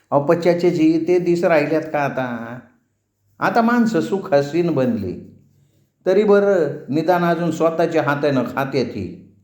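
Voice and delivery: a hint of a teasing passenger; lightly voiced woman passenger. Woman passenger ribbing the ferryman about old days, lightly teasing.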